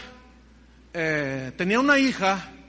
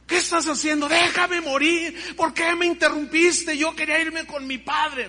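anguished protest; 7 semitones higher, 4 dB louder than usual. Retold or imitated. Woken wife protesting to her husband, high and anguished. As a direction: accuse him of stopping her.

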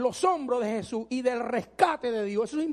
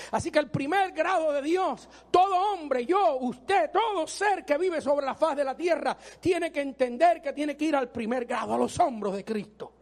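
much higher, shouted sermon climax; fiery preaching; 4 semitones higher, 3 dB louder than usual. Acted. Preacher warning people who try to help God, shouting. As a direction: preach it at the top of your voice.

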